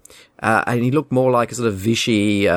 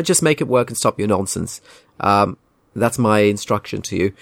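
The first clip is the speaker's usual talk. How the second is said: ordinary talk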